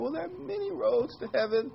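high, mocking sing-song; mocking quote. Pastor mocking TV celebrities' claim, high scornful sing-song. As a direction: mimic the TV preachers' easy line scornfully. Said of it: "famous people on T V saying".

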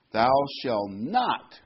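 slow build to a heavily stressed "not". Shown as a demonstration. Reading the commandment aloud in verse thirty-one, stressing "not". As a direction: lean hard on "not" and draw it out. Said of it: "emphasize the word not".

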